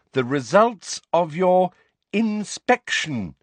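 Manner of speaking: high, loud, patronizing delivery; loud, slow voice. A narrator's reading. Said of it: "that loud, slow voice".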